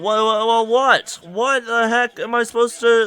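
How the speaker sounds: high, flustered disbelief; mock bewilderment. Reacting to how a girl talks, mock bewildered. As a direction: act thrown and baffled, pitch up. Acting bewilderment at girl's speech.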